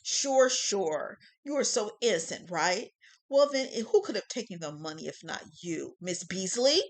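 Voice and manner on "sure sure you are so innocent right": sarcastic. Acted. Prosecutor mocks denial.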